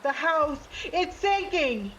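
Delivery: high, stretched-out cry of alarm; panicked yell. A narrator's reading. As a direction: yell a desperate warning. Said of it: "Yumi yelled out".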